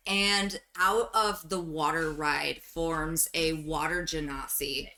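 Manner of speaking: usual speaking voice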